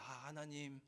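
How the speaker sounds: soft, sweet little prayer voice, half-hearted; hushed mock-pious prayer. A comfortable believer praying to God, softly pious. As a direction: murmur it like a shallow believer.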